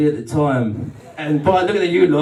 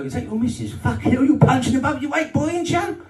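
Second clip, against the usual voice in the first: mocking bloke voice; 9 semitones higher than usual. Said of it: "people go".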